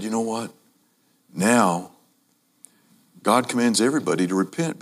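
quiet, tender appeal; gentle invitation. Shown as a demonstration. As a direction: say it softly, like good news. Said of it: "he also said".

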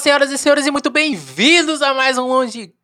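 high, loud, fast show opener; excited host intro. Acted. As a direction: greet the audience like a hyped host. Podcast opening greeting.

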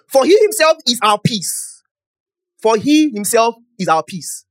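loud, slow, high proclamation; slow emphatic scripture reading. Real, not acted. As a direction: proclaim each word of the verse. Reading scripture slowly, forcefully.

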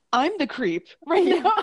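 laughing. Retold or imitated. Retold quote ends in laughter.

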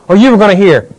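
loud, high fervent preaching; preaching at full force. Acted. Preacher pleading with the church, at full force. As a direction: press it on the congregation like a revival preacher.

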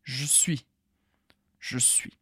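"je suis" said as "j'suis", no vowel after j; clipped "je suis"; E cut. Shown as a demonstration. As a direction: swallow the E of "je". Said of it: "a gente corta esse E".

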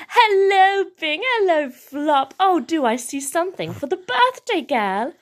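cheery sing-out greeting, starting high and loud; lightly voiced welcoming adult. A narrator's reading. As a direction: greet the children with playful delight. Amma greeting her young guests.

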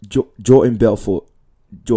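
loud imitation of a film line; lightly imitated film-character delivery; quoting the film character. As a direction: belt out the name, mimicking the movie. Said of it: "Or it was like".

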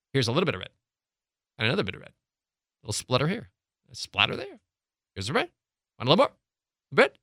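short splutters with gaps between; small bursts. Shown as a demonstration. Coach contrasting with the solid stream, small bursts. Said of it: "as opposed to".

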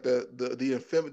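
ordinary delivery

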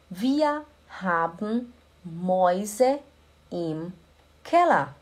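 slow, word-by-word wir haben Mäuse im Keller; very slow model. Shown as a demonstration. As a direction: stretch each word for students. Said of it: "slow model sentence for learners".

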